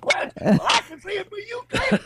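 put-on high-pitched protesting French accent. French guy saying he was just quoting, high French accent. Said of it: "if it was some French guy going".

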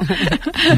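light real laugh, fairly soft; amused chuckle. Real, not acted. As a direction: chuckle at your own quip. Amused at child as silence god.

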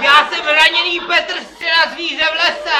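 shouting. Pretend. Shouting his role in the sketch.

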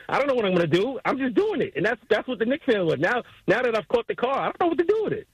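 ordinary talk

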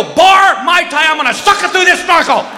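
loud, high panicked answer; mock frantic husband; frantic shout. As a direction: yell it while fleeing. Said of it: "I go".

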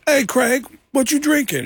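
high, put-on comedian voice; high-pitched imitation, casual; Tracy impression. Retold Tracy in the bar.

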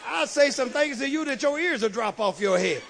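loud, pushed threat at the lady; mock threatening retort. Preacher retelling the golf course spat, loud mock retort. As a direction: bluster like you're ready to tell her off. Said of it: "I want to say".

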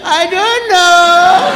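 drawn-out dopey I don't know; a kid with no idea why, slowly; clueless child. Retold or imitated. Child asked why he drank it, slow dopey shrug.

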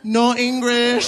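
high, slow "No English" as if playing dumb; mock no-English grandson. Pretending to the man on the train, high and slow. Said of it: "before saying".

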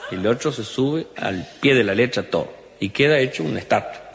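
ordinary talk

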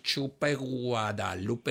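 low, slow, drawn-out gloating taunt; smug taunt. Retold or imitated. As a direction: savour the taunt slowly.

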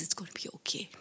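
put-on hushed, comforting whisper to a crying child; soft whisper. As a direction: whisper it gently like a mother soothing her child.

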